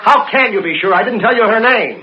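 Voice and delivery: high, loud, anxious questioning; pressing the beatnik in panic; frantic. Acted. Frantic father on phone.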